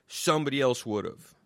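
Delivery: ordinary delivery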